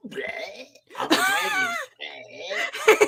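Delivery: high, loose dragon cry, drawn out; silly dragon. Acted. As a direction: squawk it as a goofy cover dragon. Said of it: "the cover is this dragon".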